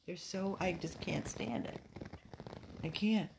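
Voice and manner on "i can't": ordinary talk, nothing marked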